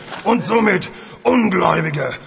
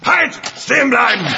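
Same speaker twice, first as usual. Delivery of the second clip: commanding shout; 3 semitones higher, faster than usual. Acted. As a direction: bark the order. Wache ruft. (befehlender Ruf)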